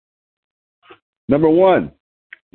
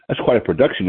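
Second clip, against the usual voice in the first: normal talking voice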